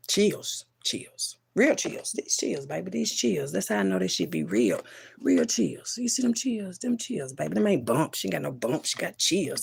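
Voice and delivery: soft, hushed repeated asides; awed by chills. Real, not acted. Showing viewers the chills mid-story, hushed awe. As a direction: drop the volume, marvel at the goosebumps. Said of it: "Baby, I got chills".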